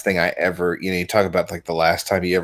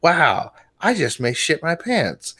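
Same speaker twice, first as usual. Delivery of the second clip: customer voice; 7 semitones higher than usual. Retold or imitated. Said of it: "the guy turns around ... and says".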